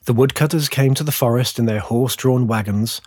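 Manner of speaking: usual speaking voice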